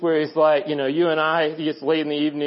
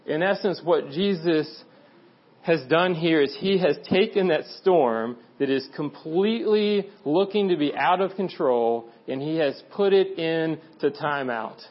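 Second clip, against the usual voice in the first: ordinary delivery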